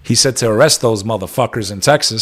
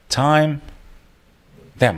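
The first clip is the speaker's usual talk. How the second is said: ordinary talk, nothing marked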